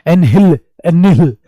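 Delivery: एनहिल repeated with extra force; stressed word root. Shown as a demonstration. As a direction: drill the root एनहिल for students. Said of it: "annihilate करने का है हिल".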